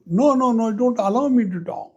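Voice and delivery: high-pitched fretful no no no, rushing on; a distressed guru begging not to be made to talk; upset guru. Acted. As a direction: refuse fast in a high, fretting voice. Upset guru refuses to lead.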